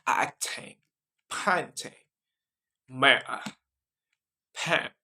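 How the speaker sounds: British accent word list with broad A. Shown as a demonstration. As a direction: read the words one by one in a British accent. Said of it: "that is British accent".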